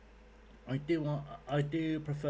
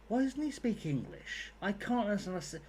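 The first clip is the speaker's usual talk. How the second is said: imitating mum; 7 semitones higher than usual. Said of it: "she was just there like".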